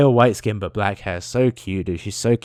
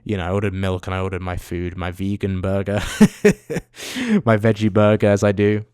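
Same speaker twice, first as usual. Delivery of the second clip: ordinary talk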